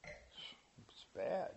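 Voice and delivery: hushed whisper; whispered aside. Real, not acted. Preacher confiding to congregation, a hushed whisper. Aside after laughing at himself.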